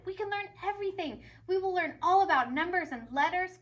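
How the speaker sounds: can't contain his delight; excited principal. A narrator's reading. Principal to the school, carried away. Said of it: "he said".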